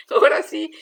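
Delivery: laughing burst, loud. Real, not acted. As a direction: break into a laugh. (riendo a carcajadas)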